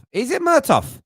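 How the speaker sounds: high, incredulous Is it Murtough; puzzled question. Shown as a demonstration. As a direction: ask Murtough in disbelief, voice jumping up.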